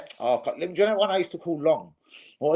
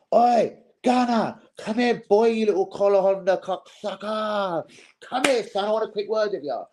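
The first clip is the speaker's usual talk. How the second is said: playact calling the guy over to fight; mock confrontation; 7 semitones higher than usual. Imagining walking up to him, hammed-up menacing call.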